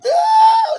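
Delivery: very high loud "ooh" squeal; delighted shriek at a compliment; excited squeal. Real, not acted. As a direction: let out a thrilled squeal. Reacting to the request.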